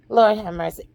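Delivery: plain reading voice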